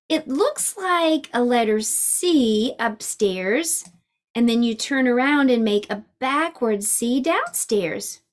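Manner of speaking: ordinary delivery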